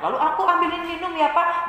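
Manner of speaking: put-on high, quick child's voice; sweet high-pitched kid; gentle, helpful child. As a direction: offer papa a drink eagerly. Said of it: "Sikap yang lemah lembut".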